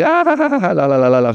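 high mock cackle, tumbling down; imitated burst of laughter. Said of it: "she burst out laughing".

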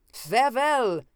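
high, loud taunting goodbye; loud singsong mock farewell. A narrator's reading. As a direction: call it out to taunt him. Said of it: "he called mockingly".

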